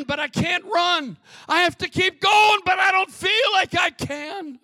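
high, urgent cry building to a shout; desperate plea. Acted. Preacher as deer fleeing the wolf, desperate rising cry. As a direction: preach it as the hunted deer, climbing in panic. Voices the hunted deer's desperation.

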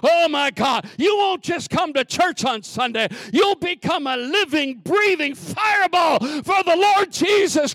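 shouted, high, loud revival preaching; fiery preaching. Acted. Preacher shouting at his church, fiery and loud. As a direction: preach it at full force to the church.

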